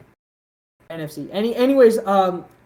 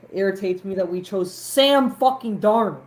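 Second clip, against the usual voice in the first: ordinary delivery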